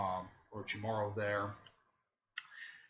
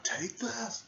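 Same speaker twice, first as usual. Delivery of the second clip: acted attack call; 11 semitones higher than usual. Character calls out an attack.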